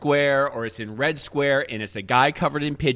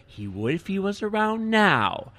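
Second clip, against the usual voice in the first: patient secretary; 6 semitones higher, slower than usual. Retold or imitated. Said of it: "she said".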